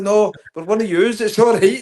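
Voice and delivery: high, loud, hammed-up pleading; mock reassuring shout. Bus lads bluffing Celtic fans, high mock-pleading shout. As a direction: shout it like you're bluffing your way out.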